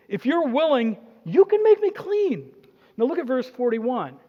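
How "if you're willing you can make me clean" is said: high, pleading plea; begging leper. Acted. Leper on his knees begging Jesus, high pleading plea. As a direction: beg on your knees for healing.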